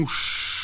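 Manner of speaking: soft breathy whoosh of wind. Acted. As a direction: blow the sound out like a gust. Wind blows the balloon.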